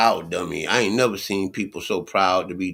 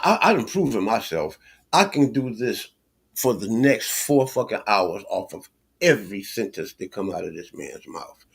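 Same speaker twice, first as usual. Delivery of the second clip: usual speaking voice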